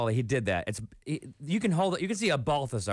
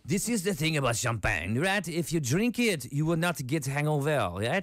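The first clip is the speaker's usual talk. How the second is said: hammed-up French accent; mock Frenchman making his pitch; 3 semitones higher than usual. Comedian imitating locals praising champagne, hammed-up French accent. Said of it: "this is my French accent".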